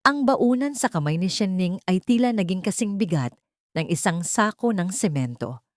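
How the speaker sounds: ordinary talk, nothing marked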